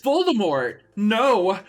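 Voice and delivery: high, loud frightened cry; scared shout. Acted. Ebony facing Voldemort on his broom, a loud high scared shout. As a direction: cry out in terror. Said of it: "I shouted in a scared voice".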